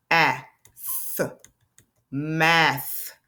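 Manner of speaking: segmented sounding out, stretching each sound. Shown as a demonstration. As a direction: tap out v, ah, f slowly. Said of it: "That's a real word".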